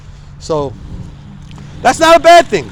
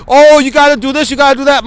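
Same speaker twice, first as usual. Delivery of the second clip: higher, louder know-it-all voice, smug; mocking know-it-all advice; 6 semitones higher, 4 dB louder than usual. Mocking people who advise him on sleep, higher and smug. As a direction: parrot the advice-givers with a sneer. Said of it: "I talk to people and I be like".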